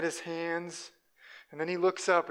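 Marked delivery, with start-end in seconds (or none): none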